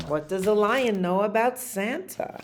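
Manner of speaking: ordinary delivery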